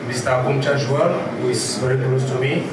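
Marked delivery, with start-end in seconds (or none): none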